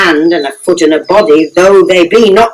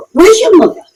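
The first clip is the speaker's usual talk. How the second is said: abrupt; 13 semitones higher, faster than usual. Acted. Sudden high question.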